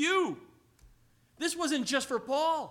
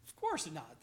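normal talking voice

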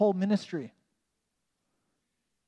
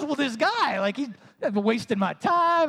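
whiny self-mockery; 8 semitones higher, 3 dB louder, slower than usual. Mocking his own complaint.

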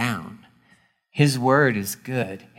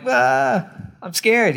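high, whiny mock fear; mock scared; 10 semitones higher, slower than usual. Believer balking at the boat, hammed-up fear.

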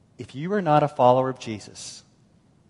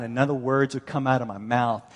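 ordinary talk, nothing marked

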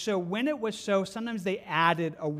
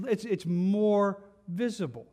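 normal talking voice

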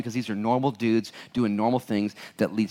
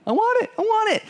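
very high, squeaky "I want it"; a mock churchgoer begging for righteousness; mock eager squeal; 4 dB louder, faster than usual. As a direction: squeal it like an overeager kid.

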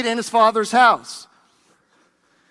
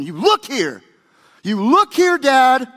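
screaming at his father; 2 semitones higher, 4 dB louder than usual. Acted. Older son to his father, angry shouting. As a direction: throw it at Dad, loud and disrespectful. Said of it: "like shouting, screaming at his father".

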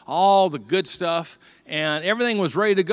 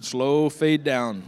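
usual speaking voice

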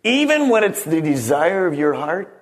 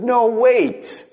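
a touch higher, gentle voice of God; hint of a divine voice; God's voice; 6 semitones higher than usual. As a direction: lightly voice God holding him back. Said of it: "God said".

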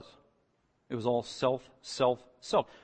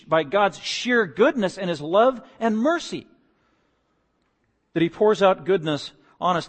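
raised, ringing pulpit voice; preaching at full force; 6 semitones higher, 7 dB louder than usual. Acted. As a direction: preach it out loud and high.

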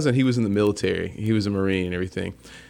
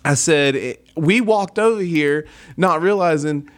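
put-on South Carolina accent kept up; 6 semitones higher than usual. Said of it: "I lay it on thick".

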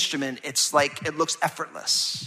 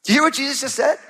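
ordinary talk, nothing marked

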